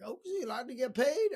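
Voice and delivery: high-pitched put-on accent; hammed-up folksy questioning; lousy Zig Ziglar. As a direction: do a bad impression of a motivational speaker. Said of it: "That was a lousy impersonation of Zig Ziglar".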